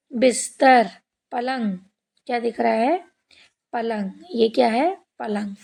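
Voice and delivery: usual speaking voice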